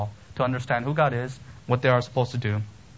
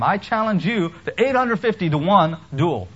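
higher, louder bold challenge to the false prophets; defiant Elijah; 5 semitones higher, 5 dB louder than usual. Acted. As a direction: throw down the gauntlet like Elijah. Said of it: "and he defied him".

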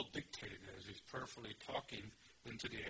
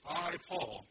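"I, Paul" higher and louder, "I" punched, then falling; emphatic I, Paul; 5 semitones higher, 6 dB louder than usual. Acted. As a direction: hit "I, Paul" hard as a declaration. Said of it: "we know it's emphatic".

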